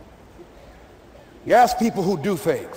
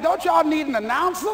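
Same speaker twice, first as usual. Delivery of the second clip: higher, shouted through laughter; dazed player cracking himself up; laughing plea; 7 semitones higher than usual. Retold or imitated. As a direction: laugh it out, begging to quit.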